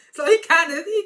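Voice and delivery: high loud laugh spilling into words; cracking up mid-story. Real, not acted. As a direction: laugh through the start of the line. Laughing while retelling.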